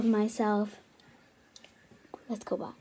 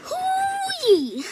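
high triumphant whoop; whooping over a clean carpet; excited whoop; 4 dB louder than usual. Real, not acted. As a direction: cheer the win.

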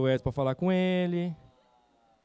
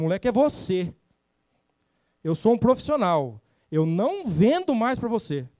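alto e firme, final devagar; retorno indignado; 5 semitones higher, 5 dB louder than usual. Retold or imitated. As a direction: devolva a ofensa com orgulho ferido. Discussão com o cliente. (resposta indignada ao cliente, alta e firme)

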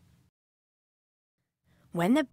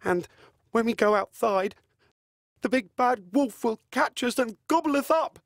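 lightly voiced worried pig, a bit higher; worried pig brother; 4 semitones higher than usual. Bigger brother worrying about the wolf, lightly voiced.

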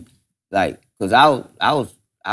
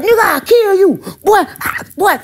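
mock threat. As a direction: threaten him in a high screech. Dirty threatening Teddy.

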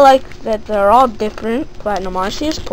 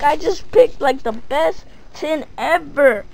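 normal talking voice

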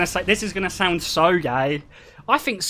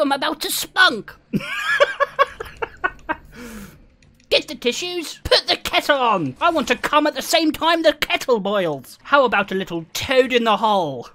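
hammed-up posh British, higher pitch; mock British; 5 semitones higher than usual. Streamer riffing on British lovers, mock posh British accent. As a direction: play a prim Brit with a kettle on. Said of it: "I wish I sounded like that".

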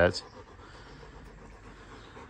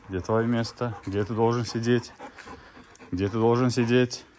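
talking to a dog; 2 semitones higher, faster than usual. Real, not acted. Scolding the dog for standing.

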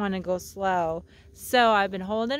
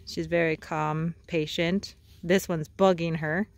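ordinary delivery

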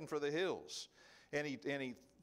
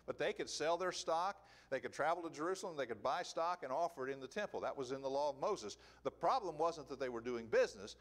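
normal talking voice

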